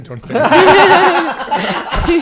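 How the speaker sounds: high, loud, slow, through laughter; pleading while cracking up; laughing; laughing plea. Retold or imitated. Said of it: "I go".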